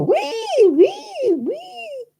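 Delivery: very high squeaky wee wee; mock advert squeal. Mocking the Celebrations advert, very high squeals. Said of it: "they go".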